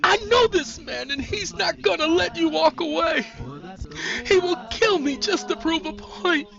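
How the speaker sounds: high, tearful, anguished appeal; desperate pleading. A narrator's reading. Sandra begging her friend for her life, tearful. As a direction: beg her, near tears.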